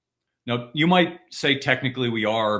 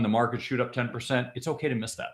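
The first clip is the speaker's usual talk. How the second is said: ordinary talk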